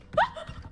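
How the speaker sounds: high airy gasp of fright; sharp startled gasp. Real, not acted. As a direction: jolt of surprise.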